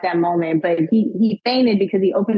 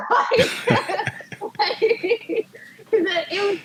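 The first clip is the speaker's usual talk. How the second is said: laughing; 11 semitones higher, slower than usual. Real, not acted. Laughing at memory.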